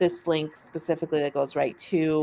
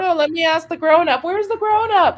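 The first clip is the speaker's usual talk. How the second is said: panicked new professional; 12 semitones higher, 5 dB louder than usual. Retold or imitated.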